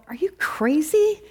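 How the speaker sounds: high, incredulous mock rebuke; judging. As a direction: judge them in disbelief, pitch jumping up. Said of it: "very easy to judge them".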